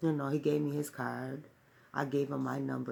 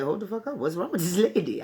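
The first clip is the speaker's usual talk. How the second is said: mock alarmed outburst, a bit louder; startled outburst; 3 semitones higher, 5 dB louder than usual. Woman startled by stranger in park, mock alarmed outburst. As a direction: jolt back, startled and cursing.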